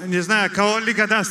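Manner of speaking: normal talking voice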